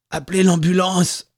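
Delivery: choked plea for help; coughing out words. A narrator's reading. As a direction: force it out after being strangled. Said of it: "toussa Joël".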